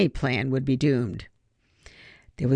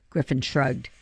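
ordinary delivery

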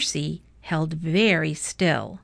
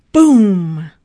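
loud, drawn-out boom; big storybook sound effect; booming crash; 12 dB louder than usual. A narrator's reading. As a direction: boom it out like a collapse.

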